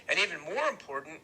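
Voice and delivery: plain reading voice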